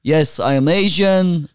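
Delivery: put-on Middle Eastern accent, lilting pitch. Guest greeting podcast listeners on request, put-on Middle Eastern accent.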